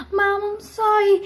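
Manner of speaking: high, slow tearful apology; mock crying. Crying child apologizing to yelling mom, mock tearful. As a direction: plead through pretend sobs. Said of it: "I was crying".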